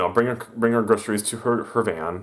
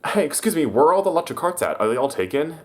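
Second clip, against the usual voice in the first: raised, higher demanding question; imitating a pushy customer, pitched up; mock loud question; 8 semitones higher, 3 dB louder than usual. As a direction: call out to the worker. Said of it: "loudly asks me".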